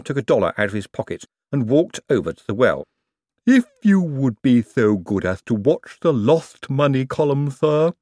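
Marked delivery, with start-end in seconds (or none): 3.4-7.9 slow, gloomy servant voice; gloomy Igor. A narrator's reading. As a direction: drag it out, gloomy and resigned. Igor again, same voice.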